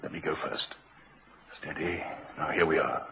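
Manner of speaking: low, hushed stealth; stealthy whisper. Acted. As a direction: creep in, barely voicing it. Sneaking into the flat.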